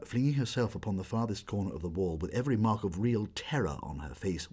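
plain reading voice

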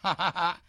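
high, short happy laugh; light child's laugh. A narrator's reading. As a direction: laugh as you spot the lost thing. Boy finds the horn.

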